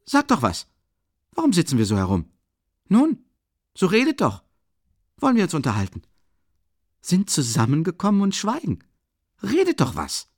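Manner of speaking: high, loud, impatient urging; sudden outburst. A narrator's reading. Urging the silent company to talk, agitated outburst. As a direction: call out as if prodding a silent room. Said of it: "rief er plötzlich ganz unerwartet".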